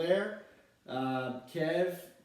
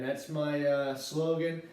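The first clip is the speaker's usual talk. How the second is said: ordinary delivery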